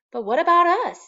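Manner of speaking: high, loud worried wife; Mrs Popper's complaint. A narrator's reading. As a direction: protest it loudly and high. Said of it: "said Missus Penguin".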